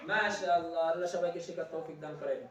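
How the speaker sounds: soft closing dua; prayer. Real, not acted. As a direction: close with a quiet prayer.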